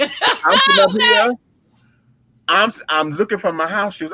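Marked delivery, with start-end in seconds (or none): 0.0-1.3 laughing. Real, not acted. Laughing while telling.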